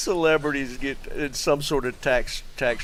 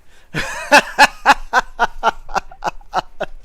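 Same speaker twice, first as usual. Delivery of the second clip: long breathless high laugh, higher and louder; real laugh; 11 semitones higher, 8 dB louder than usual. Real, not acted. Streamer laughing hard at a chat joke. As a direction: lose it completely at the joke. Reacting to funny chat message.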